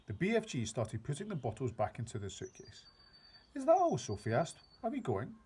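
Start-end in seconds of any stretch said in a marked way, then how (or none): 3.6-4.0 put-on very high, loud child's voice; a disappointed young girl; girl asking the giant, high and loud; little girl. A narrator's reading. Sophie's voice. 4.8-5.3 put-on high child's voice, surprised; a girl asking if they're leaving; little girl. A narrator's reading. Sophie's voice.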